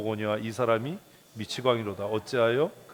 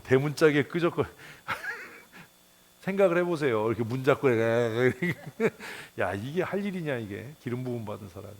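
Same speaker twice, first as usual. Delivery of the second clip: ordinary delivery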